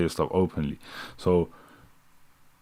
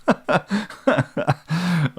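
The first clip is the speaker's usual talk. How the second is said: real laugh, pitched up; cracking up at his own joke; high burst of laughter; 9 semitones higher than usual. Real, not acted. Streamer bursting into high laughter at his own bit.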